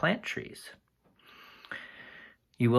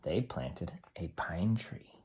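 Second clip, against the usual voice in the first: whisper; 4 semitones lower, 6 dB quieter, slower than usual. Acted. Hushed aside over the pictures.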